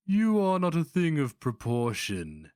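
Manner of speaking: sneered. A narrator's reading. Sorcerer sneers at the barbarian's body, high sneer. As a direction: look down on him with a sneer. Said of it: "Shinerta sneered".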